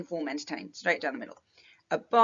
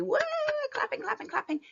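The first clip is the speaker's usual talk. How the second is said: high whooping imitation of a cheering crowd; much higher playful woo and clapping; mock crowd cheer; faster than usual. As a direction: whoop it up like the studio audience. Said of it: "if you can imagine".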